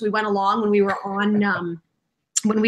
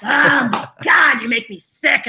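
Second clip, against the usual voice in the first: drawn-out, put-on voice of disgust; Nick; 3 semitones higher, slower than usual. Imitating best friend sick of her, put-on voice. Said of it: "She's got this voice".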